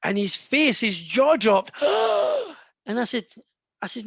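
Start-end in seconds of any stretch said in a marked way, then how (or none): 1.8-2.5 sharp high gasp of shock. Retold or imitated. Son gasps as his father guesses his secret, a shocked high gasp. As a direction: gasp like your jaw just dropped.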